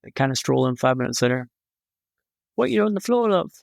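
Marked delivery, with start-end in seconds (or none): 2.5-3.5 mock British nurse, pitched higher; a jokey British accent for the nurse. Nurse asking the fallen patient, in a mock British accent. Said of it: "fantastic British accent you have there".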